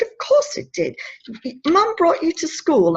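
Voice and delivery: high-pitched, insistent contradiction; emphatic reassurance. Retold or imitated. Woman countering a despairing boy, insistent reassurance. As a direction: counter him brightly, pitch jumping up.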